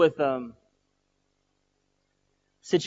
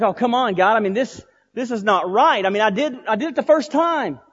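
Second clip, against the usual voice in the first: raised, high-pitched complaint; exasperated protest; 6 semitones higher, 5 dB louder than usual. Retold or imitated. Pastor objects to God that he already did it, exasperated. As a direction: argue back, voice climbing.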